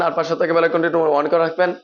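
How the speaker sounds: ordinary talk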